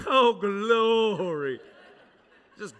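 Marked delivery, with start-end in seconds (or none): 0.0-1.6 slow, stretched and louder; drawn-out, louder joyful exhale; blissful relief. Retold or imitated. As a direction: sigh it out like sinking into heaven. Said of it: "I was like".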